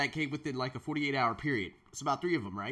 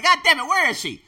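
mock frustration; 11 semitones higher, 8 dB louder than usual. Lovesick boy waiting for crush.

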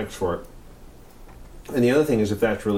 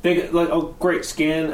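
excited; 8 semitones higher than usual. Real, not acted. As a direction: pitch jumps up, insisting eagerly. Excitedly insisting it was real.

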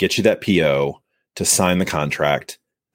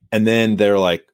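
normal talking voice

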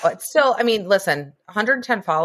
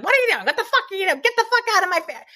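mock Italian mother yelling; 11 semitones higher, much faster than usual. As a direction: squeal it like an Italian mom scolding the kids. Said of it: "All she did was yell".

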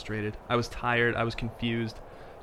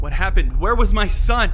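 panicked; 9 semitones higher, 6 dB louder than usual. Acted. Panicked father narrating.

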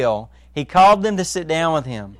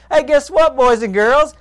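playful tease; 6 semitones higher, 4 dB louder than usual. Acted. Playful teasing announcement to congregation.

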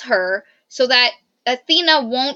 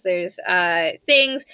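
normal talking voice

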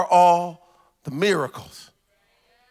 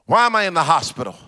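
usual speaking voice

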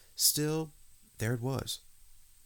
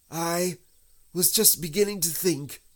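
high, louder, plaintive bear voice; slightly sniffly; 11 semitones higher, 6 dB louder than usual. A narrator's reading. Stuck Pooh confides in Christopher Robin, slightly tearful. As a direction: fret plaintively in a raised pitch. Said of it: "sniffing slightly".

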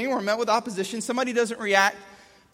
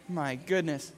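ordinary talk

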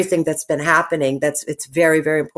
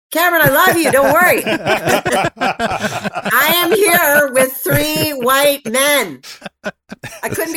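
laughing; 9 semitones higher, 4 dB louder, slower than usual. Real, not acted. Laughing while teasing colleague.

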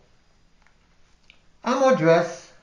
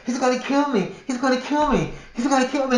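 high retold cries of "he's gonna kill me"; imitating a frantic scream for the guard; panicked screaming; 8 semitones higher than usual. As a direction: call for help in a high panic. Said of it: "I'm screaming for the security guard".

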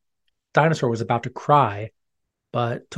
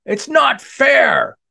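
slow, high, loud furious yell; angry scream; 12 semitones higher, 6 dB louder, much slower than usual. Acted. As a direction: scream it out in a tantrum. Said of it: "Dinosaur screamed in anger".

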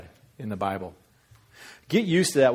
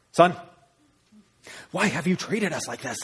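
higher-pitched scolding parent; angry mother; 6 semitones higher than usual. Acted. Mother scolding her son in the temple, angry. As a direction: demand an explanation from your child. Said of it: "imagine she said it like that".

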